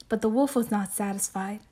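ordinary delivery

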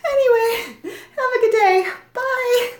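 high, laughter-tinged sign-off; giggly goodbye. Acted. Getting away from the man she offended, laughing nervously. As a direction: bid a hasty farewell through the giggles.

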